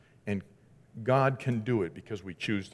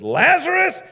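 a high, ringing shout of a name; Jesus calling loudly into the tomb; 14 dB louder, much slower than usual. Acted. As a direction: call him out of the tomb at full voice. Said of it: "called in a loud voice".

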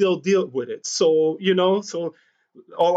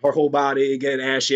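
normal talking voice

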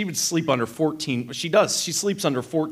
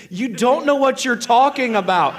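high, loud retold retort; exasperated wife; 8 semitones higher, 5 dB louder than usual. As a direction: imitate a wife snapping back. Acted quarrel, wife's side.

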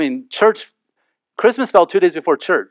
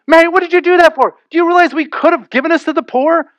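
scolding Mary; 11 semitones higher than usual. Retold or imitated. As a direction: scold her openly in front of Jesus. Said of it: "It's no longer under their breath".

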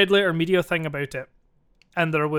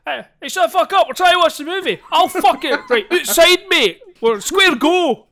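much higher, louder ranting yell; furious shout; 10 semitones higher, 8 dB louder than usual. Acted. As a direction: threaten to take it outside. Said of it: "quite a good wee skit".

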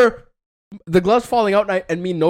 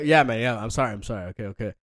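much lower, fading to a mumbled brush-off; low dismissive mumble, getting softer; 12 semitones lower, 7 dB quieter, faster than usual. Retold or imitated. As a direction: wave it away, not meaning sorry. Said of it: "says it in a way that's kind of dismissive".

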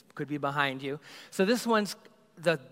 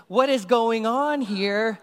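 slow, louder, higher drawn-out question; slow disbelief; 6 semitones higher, 7 dB louder, much slower than usual. Retold or imitated. Passenger watching cockpit repairs, slow baffled question. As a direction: stretch it out, baffled.

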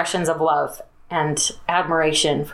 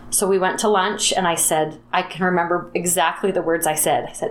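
normal talking voice

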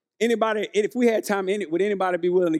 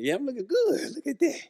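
admiring self; 6 semitones higher, 4 dB quieter than usual. Retold or imitated.

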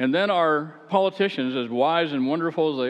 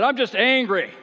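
much higher, louder angry shrug; mock angry driver; 10 semitones higher, 5 dB louder than usual. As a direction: blurt it like a raging driver. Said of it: "He said".

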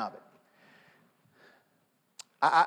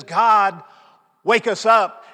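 louder, pressing plea; more emphasis; 7 dB louder, slower than usual. Retold or imitated. Praying leader calls on God, louder plea. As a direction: raise the plea, imitating the leader. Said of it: "this time with a little more emphasis".

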